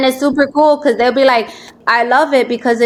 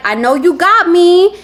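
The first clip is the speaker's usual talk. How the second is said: high, louder, slow and teasing; playful reply; 6 semitones higher, 4 dB louder than usual. Retold or imitated. Host imitating a caller answering her slogan, high and teasing. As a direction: tease the line back.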